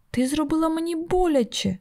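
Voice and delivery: understated narrated screech; mock child's shriek. A narrator's reading. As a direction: squeal the accusation like a hurt child, held back. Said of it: "заверещав Зігі".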